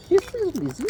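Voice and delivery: high cooing pet talk. Real, not acted. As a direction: coo it up high to the bird.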